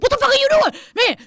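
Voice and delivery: mock road rage at another driver; retold angry yell. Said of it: "he was like".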